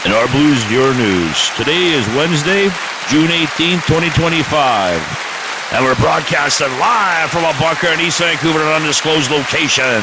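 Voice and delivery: podcast host greeting listeners; announcer intro. Acted. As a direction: open the show like a radio host. Podcast opening announcer read.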